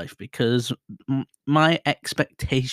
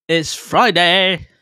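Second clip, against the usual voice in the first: drawn-out Friday cheer; 6 semitones higher, 8 dB louder, much slower than usual. Acted. Hyping himself up about Friday.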